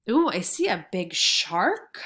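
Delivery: ordinary talk, nothing marked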